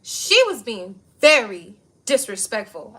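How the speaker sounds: slow, loud, haughty imitation of Betty; mocking Betty. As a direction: drag out the complaint like a prim old lady. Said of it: "So Betty go".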